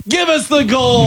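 high, slow shouted cowboy line; western villain shout. Retold or imitated. Retelling the actor's outlaw line as a high, slow shout. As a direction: bark it like a movie outlaw. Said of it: "He's like".

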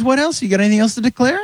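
high friendly officer voice; nice customs officer. Retold or imitated. Officer asks comedian what he has, high and friendly. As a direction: ask it sweetly, like a polite Canadian guard. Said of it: "And he was so nice".

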